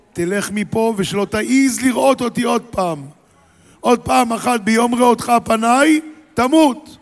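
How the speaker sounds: builds from firm to a high loud threat on תמות; rising fury, ending in a yell; threatening Pharaoh. Acted. (פרעה מאיים על משה בכעס גובר)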